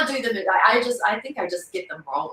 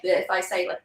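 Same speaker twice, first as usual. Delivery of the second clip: usual speaking voice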